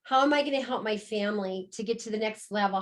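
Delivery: ordinary talk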